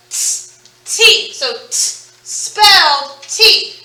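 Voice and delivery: "t" as a breathy sound, then "T" as the letter name, slowly. Shown as a demonstration. Teacher contrasting a sound with its letter name, slowly. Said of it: "How do you spell the sound t".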